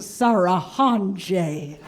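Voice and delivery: Sarahanje drawn out very slowly, each syllable savored; name read slowly. Retold or imitated. Mom reading the cab driver his name, very slowly. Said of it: "She'll read it real slowly".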